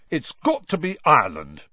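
normal talking voice

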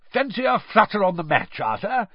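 high, eager invitation; keen, lifted voice of a betting man. A narrator's reading. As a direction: tempt a friend into a bet. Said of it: "he said eagerly".

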